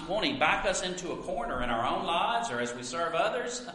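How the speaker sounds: ordinary talk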